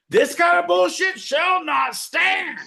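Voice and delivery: very high, slow, loud proclamation; emotional proclamation. Acted. Host rails against the show's time slot. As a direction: declare it like a wounded orator. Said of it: "I get emotional".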